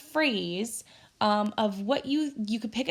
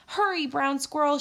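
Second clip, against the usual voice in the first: calling out; 6 semitones higher than usual. A narrator's reading. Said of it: "she called".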